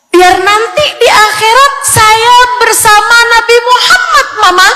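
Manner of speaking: put-on very high singsong child voice; child answering Mama. Acted child answering mother. (suara anak kecil, tinggi berlagu)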